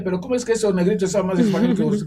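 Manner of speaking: loud, high, fast question in disbelief; imitating baffled Spanish students; incredulous Spaniards. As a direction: fire it off like shocked classmates. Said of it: "decían los españoles".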